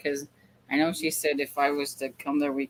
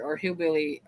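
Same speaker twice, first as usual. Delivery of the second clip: ordinary talk, nothing marked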